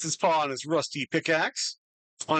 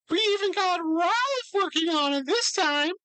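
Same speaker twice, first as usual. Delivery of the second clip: excited character line; 14 semitones higher than usual. Acted. NPC exclamation in character.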